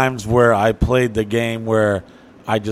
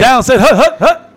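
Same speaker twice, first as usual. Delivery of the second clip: much higher, louder laughing hut calls; quarterback snap count; 12 semitones higher, 7 dB louder than usual. Retold or imitated. Driver as quarterback barking the snap count, laughing. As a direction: call the snap, cracking up. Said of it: "he's the quarterback".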